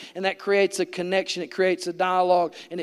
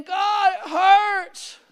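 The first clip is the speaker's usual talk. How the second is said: very high anguished shout; crying out in the night from the pain; 11 semitones higher, 4 dB louder, much slower than usual. Retold or imitated. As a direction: shout it up to God, far higher. Retold night-time cry.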